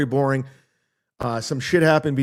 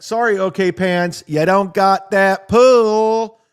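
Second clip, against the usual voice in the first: high, loud, stretched-out taunt; gloating jeer that swells at the end; mocking taunt; 5 semitones higher, 6 dB louder, slower than usual. Real, not acted. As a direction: rub it in, drawing out the last word. Taunting the doorman.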